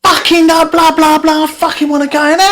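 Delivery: high mock angry woman; high-pitched mock yell of an annoyed driver. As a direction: mimic her high, angry squawk. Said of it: "and she's going".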